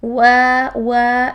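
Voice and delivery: وا said loud, with a clear w; loud drill demo. Shown as a demonstration. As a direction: call out وا for students to copy. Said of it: "وی کی sound وا نہیں ہونا چاہیے".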